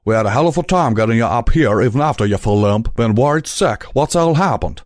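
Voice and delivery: quick, worried southern stallion. A narrator's reading. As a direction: rush it out, a touch fretful. Calamity worried, fast.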